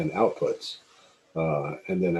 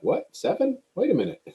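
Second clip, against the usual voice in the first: surprised disbelief. Retold or imitated. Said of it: "and I'm like".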